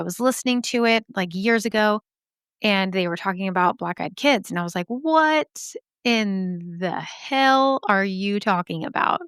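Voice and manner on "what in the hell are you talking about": very slow, stretched-out incredulous question; drawn-out disbelief. Retold or imitated. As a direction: draw out each word in mock bewilderment. Acts out her stunned reaction to podcast.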